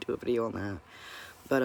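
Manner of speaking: ordinary delivery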